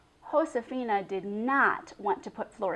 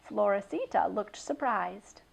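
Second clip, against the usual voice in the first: plain reading voice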